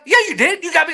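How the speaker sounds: high-pitched mock accusation; retold insistence. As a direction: press him that he did it.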